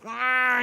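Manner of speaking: high drawn-out revving noise; imitated engine roar as the car spins; engine roar imitation. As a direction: mimic the gearing down.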